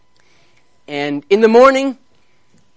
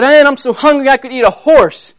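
loud, higher-pitched grumble; grouchy hungry lion; 6 semitones higher, 5 dB louder than usual. Acted. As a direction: complain loudly as a hungry lion. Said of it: "he was grouchy".

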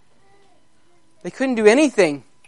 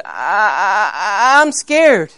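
crying, scared lion cub; much slower than usual. Acted. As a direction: whimper like a frightened little lion. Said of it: "He heard another one crying".

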